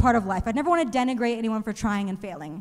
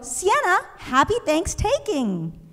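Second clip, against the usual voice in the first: higher chirpy classmate greeting; eager classmate; 6 semitones higher, slower than usual. Retold or imitated. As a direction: sing it out like an eager girl. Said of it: "she was gonna go".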